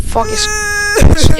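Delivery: sudden high terrified cry, dragged out; real scream. Real, not acted. Gamer startled by the monster, real terrified scream. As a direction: caught by a jump scare, shriek it. Jump scare while playing.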